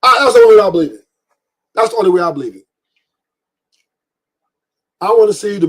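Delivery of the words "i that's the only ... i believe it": louder and fast, almost shouting; sudden loud fast insistence; emphatic outburst. Real, not acted. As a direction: blurt it out hard.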